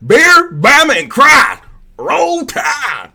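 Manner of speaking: very high, full-volume Southern holler; mock Southern yell. Streamer making fun of Southern people, shouted Southern accent. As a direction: scream it like a rabid Bama fan. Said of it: "make fun of Southern people".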